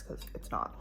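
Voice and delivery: dry whispered aside. Real, not acted. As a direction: mutter it under your breath. Disliking the green drink.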